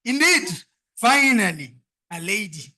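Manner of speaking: loud, emphatic MC hype; excited announcement. Real, not acted. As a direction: proclaim it to the crowd. MC hyping the room.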